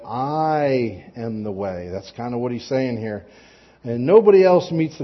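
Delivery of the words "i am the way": "I am the way." said very slowly, weight on "I"; stress on I. Shown as a demonstration. Preacher explaining the Greek emphasis, slow with stress on "I". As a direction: linger on each word of "I am the way.", pressing "I". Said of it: "it's emphasizing that".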